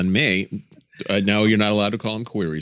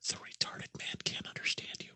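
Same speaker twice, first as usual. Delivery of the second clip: very soft whispered retort; mock confidential whisper; 19 dB quieter than usual. Grandson imagines answering grandmother in apartment, teasing whisper. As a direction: whisper back, copying grandmother's hush. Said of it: "I wanted to just say back".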